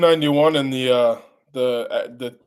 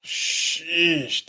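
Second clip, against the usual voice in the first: awed sheesh; 4 semitones higher than usual. Real, not acted. Awed reaction.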